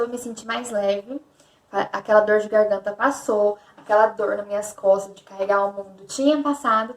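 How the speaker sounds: ordinary talk, nothing marked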